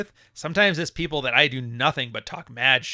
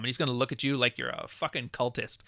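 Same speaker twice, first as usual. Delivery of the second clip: usual speaking voice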